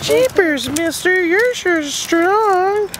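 put-on high, slow gee-whiz kid voice; wholesome 1950s kid. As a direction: gush like an old-time newsboy. Dad plays old-timey cheerleader.

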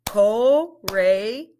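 "Courageous" broken into slow parts; syllable by syllable; split into syllables. Shown as a demonstration. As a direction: segment "Courageous". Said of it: "Let's blend it".

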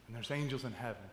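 soft, low, tender encouragement; gentle reassurance. Retold or imitated. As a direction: comfort him quietly and kindly. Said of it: "I go".

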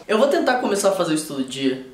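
normal talking voice

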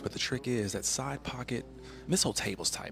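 usual speaking voice

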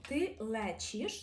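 «ти лечиш» slowly with a soft ч; teacher shows the soft ч; soft Polish sounds. Shown as a demonstration. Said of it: "досить м'якенько кажемо".

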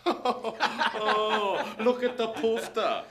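loud, laughing sneer; German-accented jeering laugh; mocking laugh. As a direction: laugh at him while you mock.

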